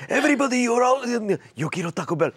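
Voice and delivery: the dog calling to the hostages; Chihuahua voice. Pretend. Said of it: "gets me out of hostage situations".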